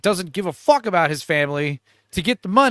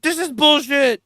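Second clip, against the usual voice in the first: mock angry complaint; 9 semitones higher, 3 dB louder than usual. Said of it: "He's like".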